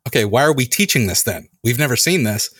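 usual speaking voice

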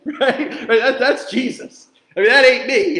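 real amused laugh while talking. Real, not acted. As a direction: chuckle through the words. Laughing at own joke.

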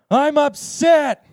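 high, drawn-out full-voice yell. Retold or imitated. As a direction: belt it out slowly, imitating a humiliated student.

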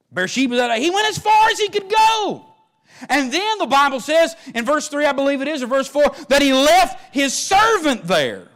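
loud, high preaching build that slows at the end; full-force preaching. Acted. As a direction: preach it out to the whole church.

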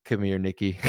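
slow, savouring mock admiration; hammed-up politician wooing a rival; Trump impression. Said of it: "He's like".

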